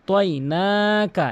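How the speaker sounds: তয়নাকা slow with না lengthened; one-beat stretch. Shown as a demonstration. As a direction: draw out না in তয়নাকা. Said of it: "এক আলিফ টেনে পড়তে হবে". (তয়নাকা-র না এক আলিফ টেনে)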